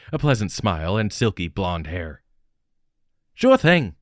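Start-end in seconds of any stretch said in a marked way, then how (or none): 3.3-3.9 put-on light New Zealand accent, higher and louder; a hint of a Kiwi lilt on sure thing. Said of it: "an Australian, or New Zealander, accent".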